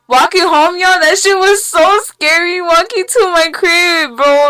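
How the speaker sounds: high, loud, slow excited telling; excited retelling. Real, not acted. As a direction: relive the fright loudly. Retelling scary walk home while high.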